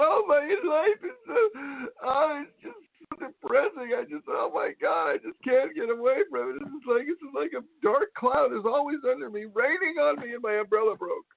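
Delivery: high, whimpering self-pity; mock crying whine. Host mocks a student whining to the shamanette, in a high crying whine. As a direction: ham up a sobbing complaint about your gloomy life. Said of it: "start complaining about".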